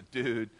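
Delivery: "Dude" with a falling, let-down tune; retold disappointment on a falling "Dude"; disappointed. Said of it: "I more showed disappointment".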